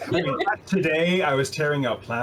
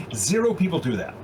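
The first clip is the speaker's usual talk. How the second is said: usual speaking voice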